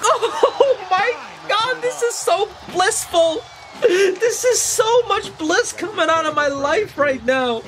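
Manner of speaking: slow, high-pitched overjoyed wail; blissful wailing. Real, not acted. Fan overwhelmed by his hero's game, slow blissful high wail. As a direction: draw it out high, overcome with bliss, half laughing.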